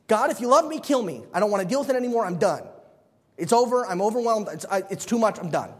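overwhelmed Moses crying out; despairing Moses. Retold or imitated. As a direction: beg God to end it, fed up. Moses paraphrased begging to die.

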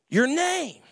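usual speaking voice

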